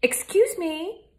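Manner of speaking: high, slow, drawn-out excuse me; piercing call. A narrator's reading. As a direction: cut through the air, slow and high. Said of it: "Florence Fusswell's voice pierced the breezy air".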